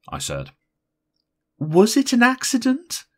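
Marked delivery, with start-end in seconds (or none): 1.6-3.0 high, loud, insistent questioning; pressing question. A narrator's reading. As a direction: press anxiously for an answer, pitched way up. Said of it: "Missus Silsburn pressed".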